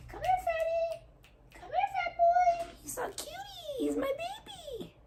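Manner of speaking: high, soft cooing to the cat. Real, not acted. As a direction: coo at the chubby cat. Baby talk to cat.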